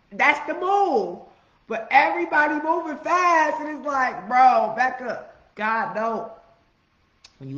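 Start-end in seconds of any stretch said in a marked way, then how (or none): 0.1-1.2 drawn out, high and loud; preacher's punchline; slow emphatic. Real, not acted. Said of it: "emphatic declaration". 1.7-6.3 high, loud, slow calling out; fired-up sermon; loud preaching. Real, not acted. Preaching at full force.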